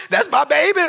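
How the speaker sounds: mock mama's doting squeal. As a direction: gush like a proud mama.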